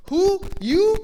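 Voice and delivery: "Who? You?" as two separate loud, high punches; emphatic. Shown as a demonstration. Boaz demands the stranger's identity, "Who? You?" punched emphatically. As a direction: hit "Who" and "You" hard, each alone. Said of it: "It's very emphatic".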